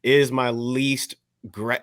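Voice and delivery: ordinary talk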